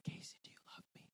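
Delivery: hushed whisper of Jesus asking. Retold or imitated. Jesus tenderly asking Casey, in a hushed whisper. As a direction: breathe the question softly, barely voiced.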